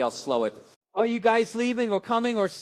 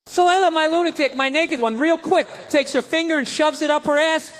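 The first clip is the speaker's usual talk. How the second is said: louder, higher reveal; loud comic reveal; 6 semitones higher, 4 dB louder than usual. Real, not acted. Comic revealing his daughter's trick to the crowd, loud. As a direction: build up and hit the punch hard.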